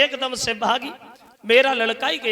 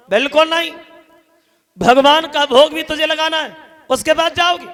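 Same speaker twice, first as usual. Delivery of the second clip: harsh refusal; 5 semitones higher, 6 dB louder than usual. Acted. Vishwamitra refusing Tara harshly.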